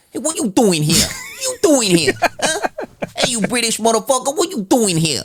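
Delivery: high, far-off cocky rat voice; street rat voice. Pretend. Friend voicing New York rats heckling a Brit, high and cocky. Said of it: "a group of rats like".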